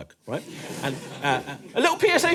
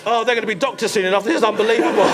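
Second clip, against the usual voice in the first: mock outrage; 7 dB louder than usual. Acted outrage in taxi.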